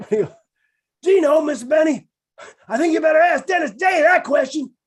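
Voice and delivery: high, loud shouted butler; Rochester voice. Retold or imitated. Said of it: "he talked like this".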